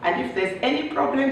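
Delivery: ordinary talk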